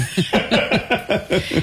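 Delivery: light high laugh; high breathy laugh. Real, not acted. Laughs at catching the lie.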